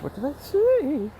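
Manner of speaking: high, slow mock-horrified question; mock dismay. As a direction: stretch it out in fake shock. Awkward joke falls flat.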